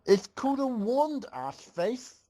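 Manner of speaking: high, snappy retort; yelled back. A narrator's reading. Twydos snapping back at Applejack, high retort. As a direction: fire back the insult high and sharp. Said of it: "Twydos yelled back".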